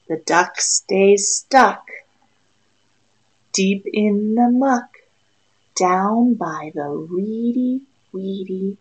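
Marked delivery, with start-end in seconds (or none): none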